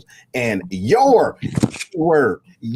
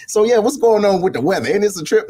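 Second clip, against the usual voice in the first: breezy subject change; 6 semitones higher than usual. Retold or imitated. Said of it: "and then flip it".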